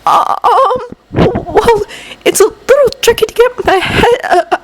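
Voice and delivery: high, hesitant stammer; shy halting reply. Acted. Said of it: "Midoriya had stammered".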